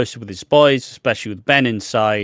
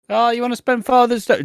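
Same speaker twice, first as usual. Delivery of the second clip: much higher, incredulous sneer; mock surprised kid; 12 semitones higher than usual. Kid mocking Phil's Father's Day request, much higher and incredulous. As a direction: act amazed he'd even ask. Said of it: "kids rejecting Phil".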